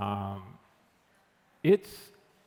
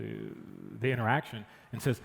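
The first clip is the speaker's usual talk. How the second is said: usual speaking voice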